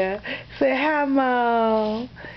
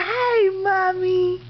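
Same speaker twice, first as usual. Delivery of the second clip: put-on baby voice; 8 semitones higher, much slower than usual. Voicing baby's greeting to mom.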